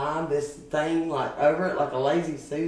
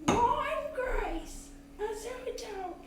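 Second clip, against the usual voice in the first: very high, soft put-on voice; imitating his high voice; 6 dB quieter than usual. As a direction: mimic the man's odd high-pitched way of talking. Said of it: "he talked like this".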